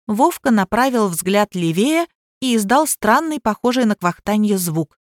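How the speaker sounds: plain reading voice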